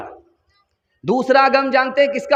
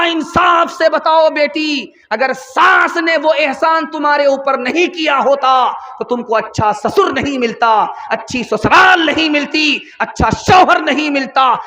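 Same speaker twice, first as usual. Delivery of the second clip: stern mother; 4 semitones higher than usual. Acted. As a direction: drive it home like a firm mother. Mother scolds daughter fairly.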